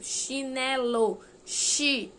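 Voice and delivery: "chinelo" said very slowly, then "chi" alone; slow syllable demo of "chinelo"; syllable split. Shown as a demonstration. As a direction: break "chinelo" down and land on "chi". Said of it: "ficou junto".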